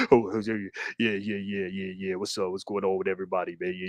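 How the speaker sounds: very low, quiet put-on deep voice. Showing how others sound waking up, very low, quiet put-on deep voice. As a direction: drop it way down, like just woke up. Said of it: "Why you sounding like that".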